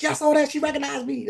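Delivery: retelling being recognized; excited squeal. Retold or imitated. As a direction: gush it, thrilled. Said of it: "I was like".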